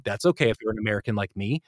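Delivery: normal talking voice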